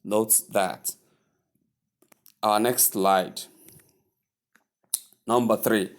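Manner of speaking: usual speaking voice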